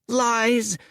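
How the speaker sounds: high accusing cry of Lies; the village boy yelling at the stranger he fears. Acted. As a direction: fling the word at him. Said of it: "cried the village boy".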